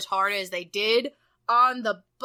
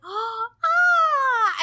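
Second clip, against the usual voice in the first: excited prince gasp; 11 semitones higher, 4 dB louder than usual. Retold or imitated. Voicing the blind prince getting a flower, high excited gasp. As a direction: gasp then a high, loud little cry. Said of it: "he gets all excited about the flowers".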